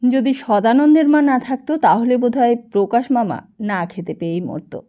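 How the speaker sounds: ordinary delivery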